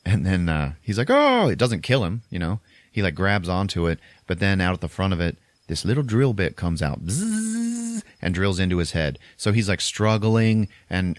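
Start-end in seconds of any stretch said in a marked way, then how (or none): 1.1-1.5 high, loud "oh" of pain; mock pained cry. Priest struck in the forehead, with a high mock yelp. 7.0-8.0 long high-pitched drill buzz; imitated drill. Podcaster imitating the drill bit, a high buzz.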